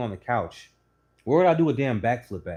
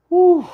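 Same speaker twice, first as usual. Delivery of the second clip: high, loud blown-out whew; huge mock exhale of disbelief; a loud retold whew; loud exasperated whew; 14 dB louder than usual.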